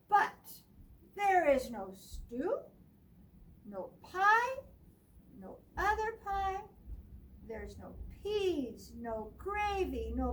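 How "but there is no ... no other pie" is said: dramatic. A narrator's reading. Dramatic list of missing food.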